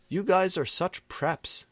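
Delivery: high, loud sneer; scornful jeer. A narrator's reading. Snap mocking the band, loud high sneer. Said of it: "Snap said".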